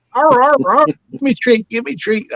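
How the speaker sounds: high yapping barks, then begging for a treat; be the dog pleading for a treat. Pretend.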